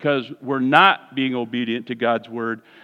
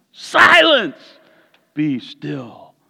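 commanding rebuke; 3 dB louder, much slower than usual. Retold or imitated. Jesus rebuking the wind, loud then hushed. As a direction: command the storm, then hush. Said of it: "I don't know if he yelled it like that".

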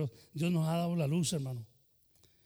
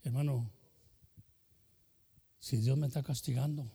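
ordinary talk, nothing marked

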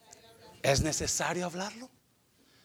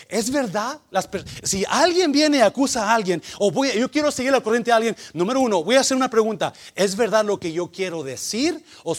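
loud, higher-pitched preacher voice; forceful preaching; 5 semitones higher, 10 dB louder than usual. Real, not acted. Preacher challenges the church about accusing someone, forceful preaching.

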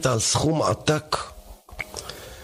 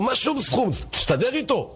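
exasperated wife; 10 semitones higher than usual. Acted. Poor wife pushes her husband.

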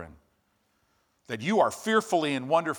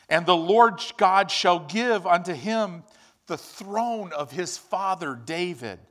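scripture reading; 3 semitones higher, 5 dB louder than usual. Acted. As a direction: proclaim it like the angel's announcement. Reading Luke aloud.

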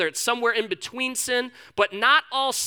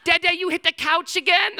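put-on high, louder little girl asking; child's voice; 9 semitones higher, 5 dB louder than usual. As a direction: play his small daughter, pitched high. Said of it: "she goes".

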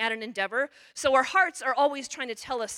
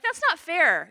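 put-on higher, louder child's protest; whiny kid; 7 semitones higher, 8 dB louder, slower than usual. Child protesting injustice, mock whine. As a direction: mimic a child calling it unfair. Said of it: "kids ... call out things that aren't fair".

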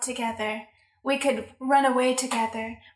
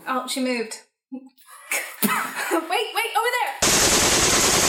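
excited spotting; 6 semitones higher, 4 dB louder, much slower than usual. Acted. Spotting target in the room.

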